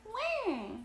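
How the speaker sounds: very high imitated baby cry; storybook wail of crying babies; mock baby wail. A narrator's reading. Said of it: "the babies cried again".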